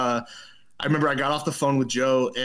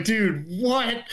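high-pitched baffled reaction; retold disbelief; 9 semitones higher than usual. Teenager replying to a threatening caller, dumbfounded. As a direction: imitate being stunned by what you heard. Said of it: "And I'm just like".

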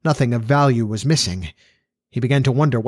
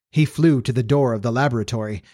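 plain reading voice

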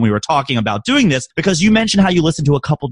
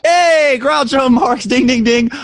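excited; 9 semitones higher, slower than usual. Real, not acted. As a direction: celebrate like a quiz host. Quiz host celebrates right answer.

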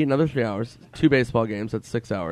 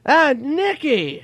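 very high, loud, drawn-out greeting; impression of a delighted buddy, squealed up high; Norm Macdonald; 7 dB louder, much slower than usual. Comedian suddenly recognising his friend, exaggerated high imitation. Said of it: "And he goes".

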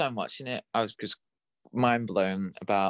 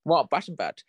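higher, posh-sounding English; posh British accent; 6 semitones higher than usual. Real, not acted. Co-host trying out a Batman nickname, sounding posh. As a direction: let it slip into a posh accent. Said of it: "that sounded so posh".

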